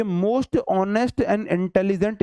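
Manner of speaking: each word of most honest and intelligent drawn out slowly; reading the correct phrase very slowly to students; slow dictation. Shown as a demonstration. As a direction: lay the example out word by word. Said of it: "इसका मतलब".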